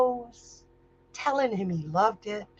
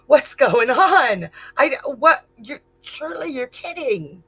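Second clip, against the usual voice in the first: stunned disbelief; 4 semitones higher, 6 dB louder than usual. Acted. Ganymede disbelieving, startled.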